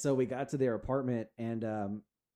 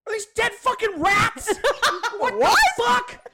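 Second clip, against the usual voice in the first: much higher, louder, slow laughing shriek; laughing shocked dad; 14 dB louder, slower than usual. Retold or imitated. Daughter retelling dad finding dead rats in freezer, shrieking. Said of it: "He's like".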